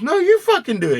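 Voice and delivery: high, loud, frantic refusal; mock panicked refusal. Dealer refusing to give the shot, high loud panic. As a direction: push the needle back at him in panic. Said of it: "He goes".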